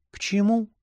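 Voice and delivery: high, meek woman's reply; gentle heroine, pitched well up. A narrator's reading. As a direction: answer meekly in a high voice. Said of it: "ответила она кротко".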